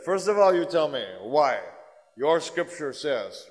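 loud, slow, high challenging demand; forceful challenge. Retold or imitated. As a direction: hammer each word slowly like a stern teacher. Guru's quote challenging the Christians.

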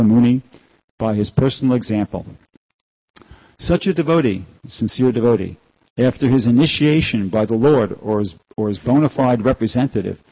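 loud, formal reading voice; scripture reading. Acted. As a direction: read the passage aloud with weight. Reads scripture aloud.

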